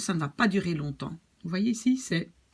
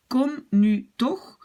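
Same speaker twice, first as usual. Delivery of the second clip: higher, slow annoyed command; slow angry insistence; teacher demoing irritated come here now; 5 semitones higher, 4 dB louder, much slower than usual. Shown as a demonstration. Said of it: "je suis irritée, fâchée".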